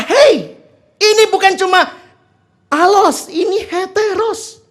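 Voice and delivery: high, loud indignant rebuke; Paul angry. Retold or imitated. Paul rebukes the Galatians, high and loud in anger. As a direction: snap back as an indignant apostle. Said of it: "Paulus kayak, ya, saya mudah-mudahan marahnya pas".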